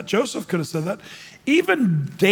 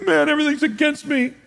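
mopey complaint; 8 semitones higher than usual. Retold or imitated. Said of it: "are you gonna be Jacob".

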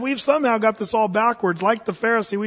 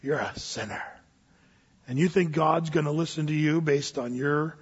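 Satan whispering; 3 semitones lower, 3 dB quieter than usual. Retold or imitated. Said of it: "whispering in your ear saying".

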